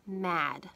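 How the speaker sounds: teacher modeling mad; modeled word. Shown as a demonstration. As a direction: say mad clearly and slowly for children to copy. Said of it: "say the word".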